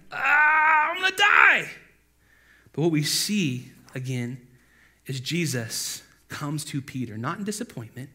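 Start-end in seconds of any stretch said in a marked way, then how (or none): 0.1-1.7 long high wail of exaggerated terror; mock panic scream. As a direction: scream it slowly, hamming up the fear. Acting Peter still panicking.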